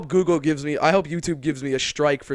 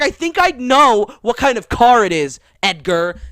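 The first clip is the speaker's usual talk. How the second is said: higher and louder, annoyed; mock indignant; 8 semitones higher, 10 dB louder than usual. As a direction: snap at him by name. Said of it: "She was like".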